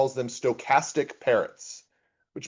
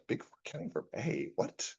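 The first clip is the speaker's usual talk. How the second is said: confused; 2 semitones higher, 14 dB quieter than usual. Retold or imitated. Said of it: "And I was like".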